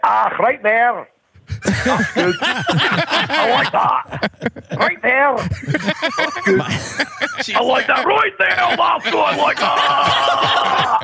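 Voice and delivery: high, slow hammed-up Scottish girl; thick Scottish accent. Comic imitating a Scottish girl in bed, thick Scottish accent. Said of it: "with that thick Scottish accent".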